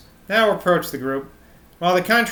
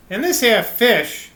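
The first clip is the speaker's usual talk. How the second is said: a hint of an old countryman's London accent, higher at the start; lightly voiced rustic London accent; 7 semitones higher, 4 dB louder, slower than usual. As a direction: lightly play the old fisherman's London accent, slow and earnest. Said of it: "reverting to his North London accent".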